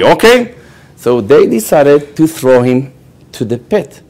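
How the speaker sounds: ordinary talk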